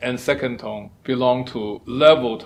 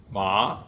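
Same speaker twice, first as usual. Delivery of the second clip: "Ma" swept upward; second tone; 4 semitones lower than usual. Shown as a demonstration. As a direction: lift "Ma" like a question. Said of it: "with a rising tone".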